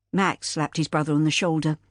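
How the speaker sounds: ordinary delivery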